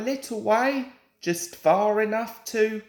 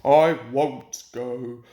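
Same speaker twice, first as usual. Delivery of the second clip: low, slow sulky grumble; grumbling; 7 semitones lower, slower than usual. A narrator's reading. Gordon refusing to turn, low sulky grumble. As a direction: grumble like a stubborn sulker. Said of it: "grumbled Gordon".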